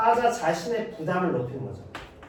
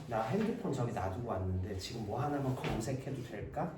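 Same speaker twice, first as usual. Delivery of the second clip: polite request; 6 semitones lower, 11 dB quieter than usual. Acted. Teacher acts polite request.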